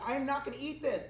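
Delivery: lightly imitated whiny refusal; whining child. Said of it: "he starts whining and literally crying".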